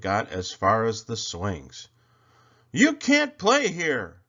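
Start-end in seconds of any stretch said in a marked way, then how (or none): 2.7-4.2 high, slow, loud bully shout; bully yelling. A narrator's reading. Bully Sammy yelling to keep Kevin off the swings. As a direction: yell it like a playground king. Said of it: "yelled Sammy".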